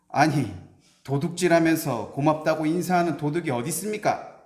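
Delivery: blunt retort. Acted. Robber bluntly retorting to the scholar's demand for thanks. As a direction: snap back bluntly in disbelief. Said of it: "퉁명스럽게 대꾸를 했다고".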